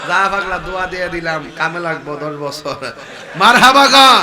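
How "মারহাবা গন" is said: much louder, higher crowd cheer; rousing shout. Real, not acted. (জোর গলায় চিৎকার করে উৎসাহ)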